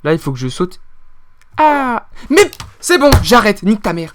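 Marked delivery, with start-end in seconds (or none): 1.6-4.1 angry quitting. Real, not acted. Abandonne en criant.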